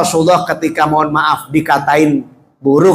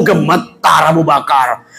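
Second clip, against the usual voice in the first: ordinary talk, nothing marked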